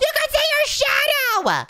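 high-pitched excited squeal; over-excited high cry; amazed shout. Pretend. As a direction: yell it high in wild amazement. Amazed at the graphics.

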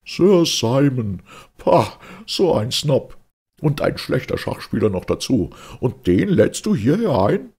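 disdainful friend mocking a snob; contemptuous snort. A narrator's reading. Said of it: "er schnaubte mit den Nasenflügeln".

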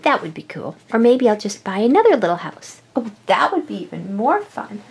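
normal talking voice

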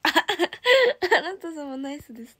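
laughing repeat. Real, not acted. Idol repeating a fan's funny comment, laughing. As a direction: savour the phrase while laughing.

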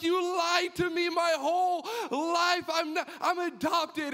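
crying accusation. Retold or imitated. Son accusing his mother of lying, high tearful wail. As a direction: wail it at her through tears.

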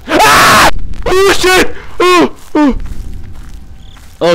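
high shriek then breathless gasps; terrified scream. Real, not acted. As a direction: caught by the monster, screaming. Jump scare in the game.

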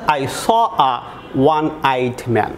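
one said slowly as wan with a glide; slow demo with one stressed. Shown as a demonstration. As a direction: lean on one, sounding it wan. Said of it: "এর উচ্চারণ হচ্ছে কিন্তু one".

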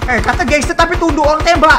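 loud scornful jab at a gangster who ducks from gunfire; mocking shout. Real, not acted. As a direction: taunt the cowardly gangster.

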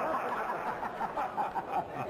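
high breathy giggle; real laugh. Real, not acted. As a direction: crack up helplessly. Breaking up mid-scene.

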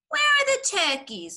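put-on high, loud young fox's question; smallest fox. A narrator's reading. Smallest fox puzzled by an empty cellar, piping up. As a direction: pipe up loudly as the littlest fox. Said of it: "asked the smallest fox".

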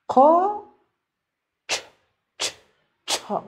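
"قا", then "چ" alone twice, then "چاق"; sounding out. Shown as a demonstration. Teacher helping pupils find a letter, sounding out "قاچاق" in pieces. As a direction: break "قاچاق" apart, isolating the "چ" sound.

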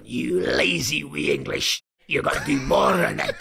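put-on furious Scottish manager shouting in his accent; shouted Scottish accent. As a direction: bellow at a lazy young player in a thick Scottish accent. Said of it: "he barked it far louder than I".

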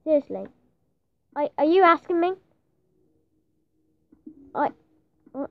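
ordinary talk, nothing marked

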